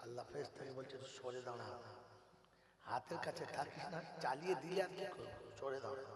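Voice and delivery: very soft, low confidential aside; low, quiet caution; hushed warning. Acted. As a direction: drop right down and warn under your breath. Allah's aside warning the angel.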